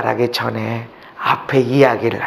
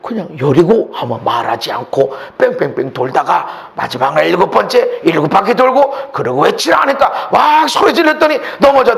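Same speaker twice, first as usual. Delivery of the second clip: usual speaking voice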